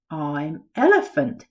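ordinary delivery, nothing marked

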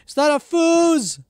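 loud, high indignant outburst; outraged shout. Real, not acted. Frustrated gamer shouting at the wrong item, loud and high. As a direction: yell at the useless find.

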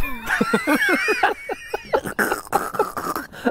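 high burst of laughter; laughing at a pun. Real, not acted. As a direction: crack up at the joke.

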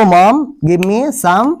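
slow, word by word, give me some; very slow drill. Shown as a demonstration. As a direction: spell it out slowly for learners. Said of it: "তাহলে আমরা বলবো".

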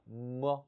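brief, light 뭐; neutral tone. Shown as a demonstration. Teacher showing toneless 경성, short 뭐. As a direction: toss off 뭐 with no tone. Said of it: "성조가 없는 건 경성이래요".

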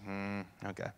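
a small hushed yielding okay; mock resigned murmur. Son quietly yielding to his mother's request, softly resigned. Jesus quietly giving in to mom.

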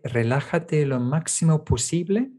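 normal talking voice

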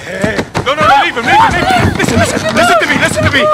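very high, loud terrified begging; desperate screaming. Acted. Prisoner screams at the captain to spare the child, terrified. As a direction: shriek to save the boy.

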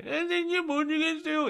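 put-on very high child voice; little schoolchild. First grader to teacher in dictation, high puzzled child voice. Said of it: "애기들이".

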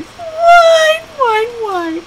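piercingly high, stretched mock whine; teasing mock whine. Owner mocking her dog's crying, high whine. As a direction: imitate a crying dog up high. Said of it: "she'd just sit there and she'd cry".

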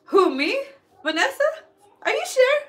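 high-pitched, rising questions; mock surprise. Streamer feigning surprise at a compliment, mock incredulous.

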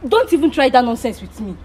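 loud, angry telling-off; heated, forceful refusal; indignant. Real, not acted. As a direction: raise your voice, fed up with repeating it. Angry rejection of a paid offer.

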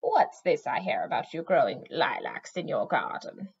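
put-on a low, accusing old crone's voice; croaky witch. A narrator's reading. Chair witch scolding Morwen over lilacs, croaky. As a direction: lean on it like a cranky witch. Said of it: "Chair Witch Arshanis croaked".

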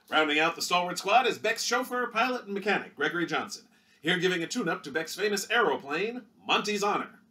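punchy vintage newsreel read; newsreel announcer. Acted. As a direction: sell it like a 1930s Movietone narrator. Newsreel narrator continues.

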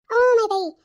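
high, loud drawn-out "oh dear"; exclaiming protest. Acted. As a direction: cry out in protest to a friend. Teasing protest to friend on phone. (मोठ्याने, उंच आवाजात उद्गार)

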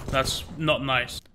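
normal talking voice

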